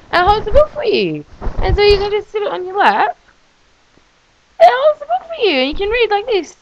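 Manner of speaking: high, loud excited squeal; excited demo. Real, not acted. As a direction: show it off delightedly. Demonstrating product excitedly.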